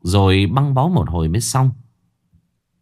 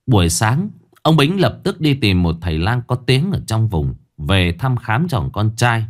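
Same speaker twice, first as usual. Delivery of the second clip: usual speaking voice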